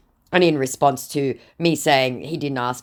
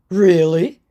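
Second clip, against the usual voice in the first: mock disbelief; 5 semitones higher, 7 dB louder than usual. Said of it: "To which he says".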